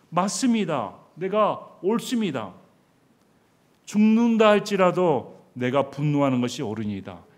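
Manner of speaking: loud insistent protest; stubborn defiant retort. Retold or imitated. Preacher voicing Jonah insisting he is right, loudly defiant. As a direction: dig in your heels, loud.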